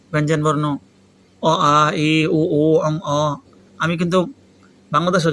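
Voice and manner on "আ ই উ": normal talking voice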